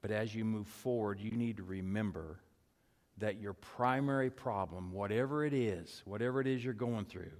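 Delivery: very soft, low, with long pauses; hushed pastoral reflection. Acted. Preacher leads congregation through a coach's mantra and breath, hushed. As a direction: guide them gently, pausing after each short phrase.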